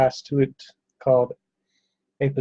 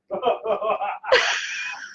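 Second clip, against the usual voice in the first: breathy laugh; 8 semitones higher than usual. Real, not acted. Laugh after show.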